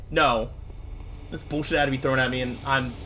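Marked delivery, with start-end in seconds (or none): 2.4-2.9 low, quiet seething; too angry to get the sentence out; real anger kept low and halting; livid, held in. Real, not acted. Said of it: "I'm so livid".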